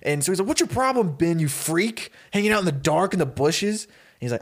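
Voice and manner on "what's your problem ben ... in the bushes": put-on raised-pitch indignant teenager; acted annoyance. Startled teenager confronts the boy in the bushes, annoyed. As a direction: play startled irritation at being ambushed. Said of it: "so you kinda get angry".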